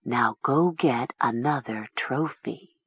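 slow, low, hushed order; soft commanding voice. A narrator's reading. The voice sends Talia after a trophy, soft and commanding. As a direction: draw the command out softly. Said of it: "said the soft voice in her head".

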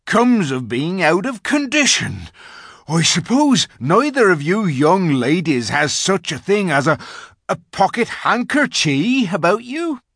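high, loud, broad country voice; rustic giant. A narrator's reading. Giant asks the young ladies for a handkerchief, polite. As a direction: ask politely in a big yokel's voice. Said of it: "said the giant".

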